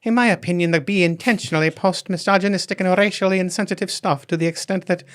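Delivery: a bad Irish lady accent, pitched high. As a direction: read her tweet as a bad Irish lady. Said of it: "I can't do an Irish lady".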